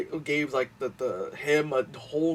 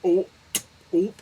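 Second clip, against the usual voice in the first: mock realization; 7 semitones higher, 5 dB louder than usual. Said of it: "that girl is like".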